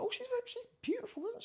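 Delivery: imitating mum. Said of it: "she was like".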